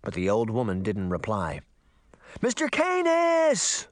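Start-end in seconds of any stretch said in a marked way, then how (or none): 2.4-3.9 desperate drawn-out call. A narrator's reading. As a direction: call out for help. Calling for help.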